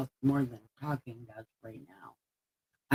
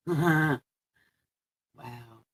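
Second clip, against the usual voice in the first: ordinary talk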